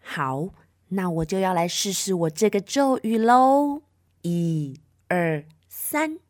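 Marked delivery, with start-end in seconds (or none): none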